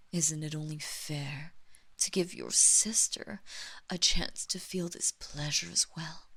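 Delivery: breathy seductive whisper. Acted. Vampire mistress whispering to her servant. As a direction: whisper it close to the ear.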